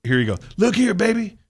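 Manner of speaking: high, loud preacher-style mentor voice; mentor's voice. Retold or imitated. Quoting his mentor's toothpaste lesson to the host, high and loud. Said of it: "He said".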